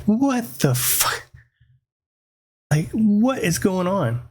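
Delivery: drawn-out, high-pitched mock disbelief; retold bewilderment. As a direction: stretch it out high, acting stunned. Retold shock on waking from nightmare.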